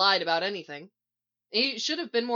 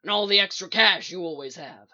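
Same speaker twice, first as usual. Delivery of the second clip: loud, gruff follow-up demand; rough, forceful interrogation; 6 dB louder than usual. A narrator's reading. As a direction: press the question hard. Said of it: "Mick demands".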